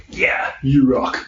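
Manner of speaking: slow, high, drawn-out mock cheer; cheesy cheerleader. Mocking fair-weather supporters, a slow cheesy cheer. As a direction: ham up a cheesy pep-talk cheer. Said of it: "show up all the time and go".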